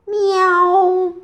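high, loud, long meow; loud cat yowl. A narrator's reading. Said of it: "威尔伯大叫一声".

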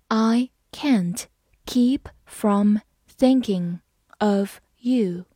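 slow phrase-by-phrase reading. Shown as a demonstration. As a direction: read it in slow chunks. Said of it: "好，慢慢来读。". (分段慢读)

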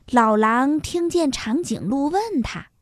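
plain reading voice

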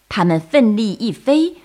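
usual speaking voice

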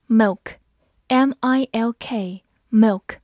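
slow, letter-by-letter spelling of milk. Shown as a demonstration. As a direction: spell it out slowly. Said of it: "spells the word slowly". (慢速拼读)

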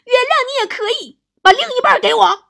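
high, loud demanding wife; huff, then shout the demand; angry. Acted. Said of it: "王嫂当时就生气了". (王嫂气呼呼地高声要求)

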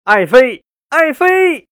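longing acted cry; repeated calling. A narrator's reading. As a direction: call out to a beloved, climbing in pitch. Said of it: "口中还连声叫着".

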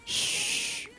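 put-on whispered shushing to children; very soft bedtime whisper; hushed mother. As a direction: whisper them to bed without waking the neighbor. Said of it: "他们妈妈压低声音说".

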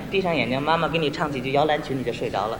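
very soft, low bedtime coaxing; imitated mother, fading to a murmur; young mother. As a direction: lull a baby with hushed talk. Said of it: "这个女同志一看小姑娘".

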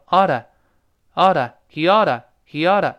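"oughta" as "AH-duh", first syllable punched; slow model of "he oughta" with a flapped d; linked American reading. Shown as a demonstration. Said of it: "读成oughta". (慢速示范"oughta"连读,重音在前)

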